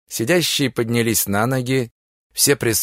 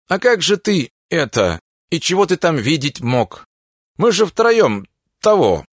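higher, louder flustered protest; indignant disbelief; 4 semitones higher, 3 dB louder than usual. Acted. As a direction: sputter in loud disbelief.

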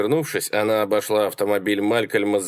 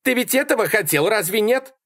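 high-pitched indignant woman; high, accusing retort; defiant Sally; 12 semitones higher than usual. A narrator's reading. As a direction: challenge him in her high voice. Sally shouting back while leaving.

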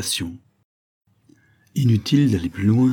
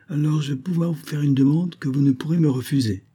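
put-on higher-pitched child's voice; little girl; 7 semitones higher than usual. A narrator's reading. Girl asks her parents a favour, little girl voice.

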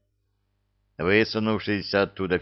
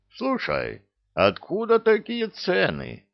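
high, outraged question; drawn-out indignant protest; 12 semitones higher, slower than usual. A narrator's reading. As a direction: demand an explanation, voice leaping up. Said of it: "возмутился царь".

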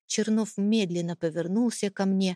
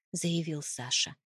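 plain reading voice

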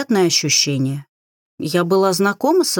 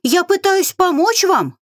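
squeaky voice; 12 semitones higher than usual. A narrator's reading. Same squeaky healer continues.

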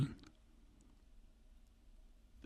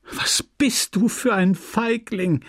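pitched far higher, slow and taunting; scornful cry; 10 semitones higher, slower than usual. Acted. Emma taunting Leon as a coward, high scornful cry. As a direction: hurl the insult at him from up high. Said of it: "rief sie".

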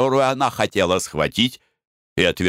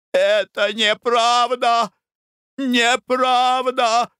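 high, slow screaming denial; hysterical cry; 13 semitones higher, much slower than usual. A narrator's reading. Mother screams denial at the letter, high and slow. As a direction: cry out in despair. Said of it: "кричала леди Клевринг".

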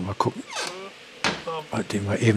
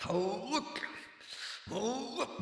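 effort grunt; 12 semitones higher, 16 dB quieter, slower than usual. Acted. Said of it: "Mann, ist der schwer".

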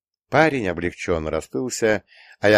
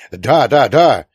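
loud, raised, eager repetition; ardent insistence; 5 semitones higher, 10 dB louder, slower than usual. A narrator's reading. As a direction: insist hotly, hammering each word. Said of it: "горячо твердил он". (горячо, громко твердя)

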